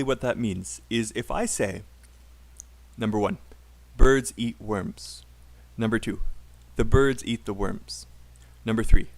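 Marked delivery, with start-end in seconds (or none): 4.0-5.2 slow, evenly stressed "Birds eat worms"; stressed beats. Shown as a demonstration. Teacher showing stress timing to students, slow stressed beats. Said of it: "take the same time". 6.8-8.1 "birds", "eat", "worms" on the beat, each "the" short; stressed beats. Shown as a demonstration. Teacher showing English rhythm, stressed content words. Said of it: "take the same time".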